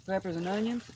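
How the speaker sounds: talking with mouth full; words round a mouthful of food. Real, not acted. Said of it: "to talk with your mouth full".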